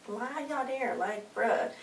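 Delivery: high, hushed disbelief; incredulous reaction. Retold or imitated.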